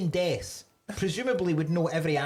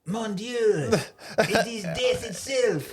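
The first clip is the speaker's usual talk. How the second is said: slow, hammed-up French accent; mock French accent, drawn out; 3 semitones higher, 3 dB louder, slower than usual. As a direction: put on a theatrical French accent. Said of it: "if she'd spoken French".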